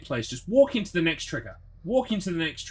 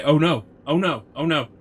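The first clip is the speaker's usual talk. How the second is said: mock dismay; 7 dB louder than usual. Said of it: "I'll just have to say".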